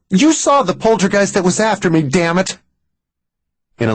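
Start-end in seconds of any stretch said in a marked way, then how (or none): 0.1-2.6 high, heated protest; frustrated outburst. Acted. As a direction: push back at him, upset. Mary snaps at doubting Max.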